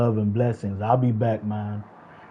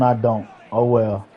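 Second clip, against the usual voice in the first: ordinary delivery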